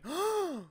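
high, sharp audience gasp; mock shocked intake of breath; imitated gasp. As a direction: gasp like a whole stunned theater. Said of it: "just a massive gasp around".

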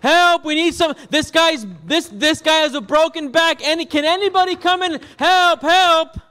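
high, loud shouts for help; desperate cry. Acted. Desperate people kicking the church door, shouting for help. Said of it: "crazy, desperate people would just start kicking the door like".

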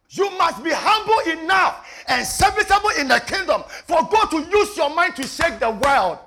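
high, loud shouted sermon; full-force preaching. Real, not acted. Preacher urging humble service in the kingdom, shouted. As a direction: thunder it out to the congregation.

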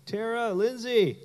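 high-pitched call to friends; calling out names. Retold or imitated. Man calls his friends after hearing noises, calling out. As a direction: call out for someone in the house.

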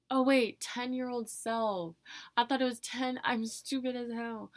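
loud, high burst of self-mocking surprise; real startled exclamation at own mistake; sudden realization. Real, not acted. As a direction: blurt it out as the mistake dawns on you. Realises she misread the question.

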